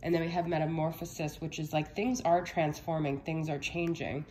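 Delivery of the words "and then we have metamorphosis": ordinary delivery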